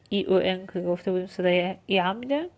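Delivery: ordinary delivery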